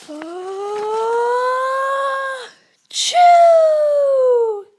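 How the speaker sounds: a giant bear sneezing; huge sneeze. Acted. The bear sneezes and wakes the whole party. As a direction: sneeze built high then blasted very loud.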